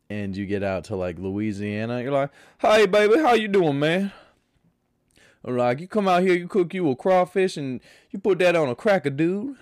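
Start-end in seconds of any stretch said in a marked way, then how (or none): 2.6-4.1 put-on higher, louder Louisiana accent; hearty Louisiana greeting, raised voice; mock Louisiana accent, loud and friendly. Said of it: "the Louisiana accent". 5.8-9.6 put-on Louisiana accent. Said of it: "the Louisiana accent".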